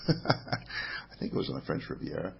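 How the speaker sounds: breathy, half-laughed afterthought under his breath; laughing aside. Real, not acted. Host laughing at his own fuzzy memory of losing the magazine. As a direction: chuckle the words out quietly, doubting yourself.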